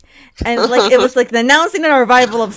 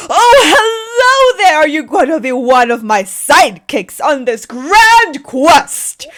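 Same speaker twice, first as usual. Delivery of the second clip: eager knight; 7 semitones higher, 8 dB louder than usual. Acted. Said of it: "he is like".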